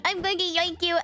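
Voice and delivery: usual speaking voice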